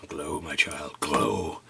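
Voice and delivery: low, drawn-out mystic command; mock incantation. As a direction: playfully intone it deep, like summoning a glow. Playfully commands glowing figurine to glow.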